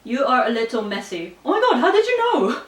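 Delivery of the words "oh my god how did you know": high, loud mock surprise; mock shocked. Reacting to a viewer's guess, playfully shocked. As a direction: gasp it out as if caught red-handed.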